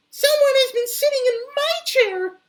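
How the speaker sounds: very high, loud squeaky cry; crying Baby Bear. Acted. Baby Bear finds his chair broken, very high loud cry. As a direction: wail it like a tiny upset cub. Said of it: "he cried".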